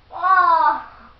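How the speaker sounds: loud pained ah; pained cry. Real, not acted. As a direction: cry out in pain. Brain freeze while chugging.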